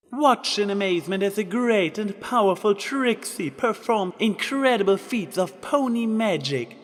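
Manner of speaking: dazzle the crowd; showman announcement. Acted. Trixie performs for crowd.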